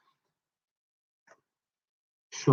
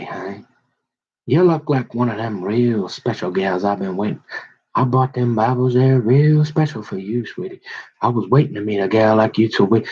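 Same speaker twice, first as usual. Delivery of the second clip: lower, oily sweet-talking charmer; smarmy suitor; 4 semitones lower than usual. Retold or imitated. Comedian plays a Bible-toting suitor, lower and oily. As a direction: sweet-talk her like a sleazy church romeo.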